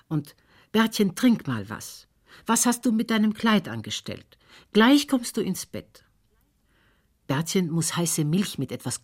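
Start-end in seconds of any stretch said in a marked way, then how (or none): 0.7-6.0 put-on high-pitched mother calling to her child; fussing mother, voice raised high. A narrator's reading. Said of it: "ruft die Frau Siljas". (mit hoher Stimme rufend)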